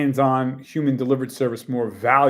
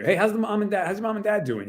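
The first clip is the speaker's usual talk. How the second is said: higher-pitched friendly inquiry; friendly check-in; 8 semitones higher, faster than usual. Acted. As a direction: check in on a client's family. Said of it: "checking in on you".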